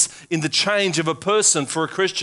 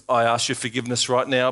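normal talking voice

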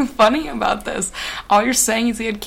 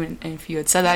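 ordinary talk, nothing marked

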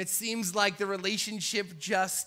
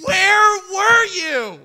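slow, much louder and higher demand; slow indignant cry, much higher and louder; 11 semitones higher, 15 dB louder, much slower than usual. Acted. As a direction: hammer each word like an angry Karen.

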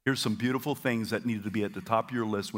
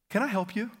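bright offer; 9 semitones higher than usual. Shown as a demonstration. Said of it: "Here's another one".

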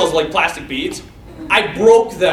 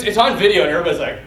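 usual speaking voice

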